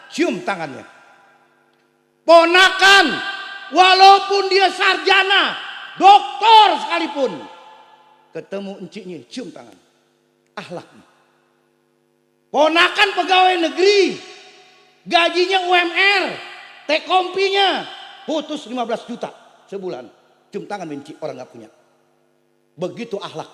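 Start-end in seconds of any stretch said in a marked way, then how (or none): 2.3-7.3 high, loud preaching cry; preaching shout. Acted. Preacher driving home respect for aunts, shouting. 12.5-20.0 high, loud preaching cry; preaching shout. Acted. Preacher driving home respect for aunts, shouting.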